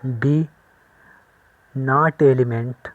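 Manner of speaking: very slow, every syllable drawn out; slow dictation. Shown as a demonstration. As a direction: dictate it slowly to the class. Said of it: "ऐसे ई बनाएंगे इस तरह".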